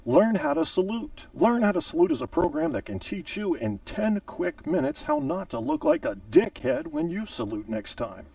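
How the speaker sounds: high, upbeat sponsor pitch; mock ad read. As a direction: sell it like a cheesy ad. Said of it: "And now a word from our sponsor".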